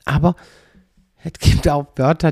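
ordinary delivery

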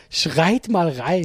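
langsam und spöttisch nachgeäfft; spöttisch zitiert. Retold or imitated. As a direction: die Bitte langsam verhöhnen. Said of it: "Vor allem einfach so". (spöttische Nachahmung, langsam)

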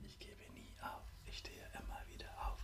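soft whispered repeat; whispered echo. Shown as a demonstration. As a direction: whisper it back. Repeat-after-me affirmations.

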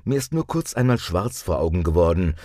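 normal talking voice

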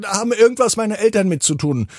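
put-on high, quick child voice; curious boy. A narrator's reading. Dennis answers the scarecrow, high quick child voice. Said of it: "Dennis überlegt kurz und sagt".

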